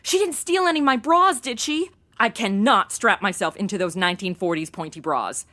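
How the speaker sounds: usual speaking voice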